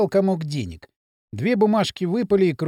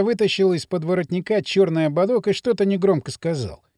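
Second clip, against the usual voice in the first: ordinary delivery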